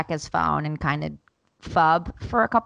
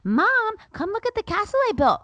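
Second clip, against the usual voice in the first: high, loud imitation of a little kid; insistent child calling; 8 dB louder than usual. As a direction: pester Mom from downstairs, high-pitched. Voices daughter calling for mom.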